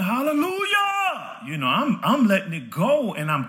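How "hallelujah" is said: high, drawn-out hallelujah wail; retold praise scream. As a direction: cry it out high and long, without full volume. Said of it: "I'm screaming".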